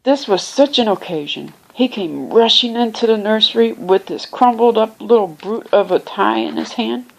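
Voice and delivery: usual speaking voice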